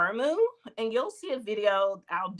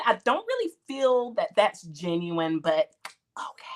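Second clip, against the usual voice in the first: ordinary talk, nothing marked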